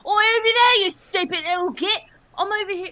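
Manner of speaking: high, loud Cockney shout; Cockney brawler yelling at a foe; yelled Cockney taunt. Acted. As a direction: bellow the Cockney insult across the room. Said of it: "I've always wanted to try Cockney".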